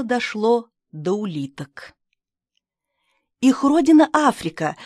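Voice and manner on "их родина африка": a touch of inspired enthusiasm, a bit higher; mildly rapt announcement. A narrator's reading. Vera proudly tells the narrator about snails, mildly inspired. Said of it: "вдохновенно вещала Верка".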